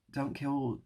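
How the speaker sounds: ordinary talk, nothing marked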